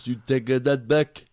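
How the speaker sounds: put-on French Canadian accent, mouth full of peanut butter. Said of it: "sounds like he's deaf and has peanut butter in his mouth".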